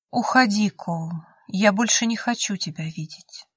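ordinary delivery, nothing marked

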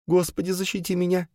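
high-pitched panicked prayer; high, scared cry without shouting; frightened plea. A narrator's reading. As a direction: plead for protection from hiding. Terrified woman praying inside the chest.

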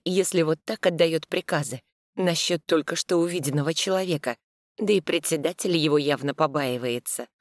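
usual speaking voice

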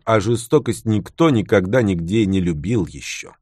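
ordinary talk, nothing marked

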